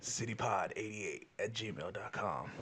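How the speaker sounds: mock announcer. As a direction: read it like a deep radio plug. Repeating the email address.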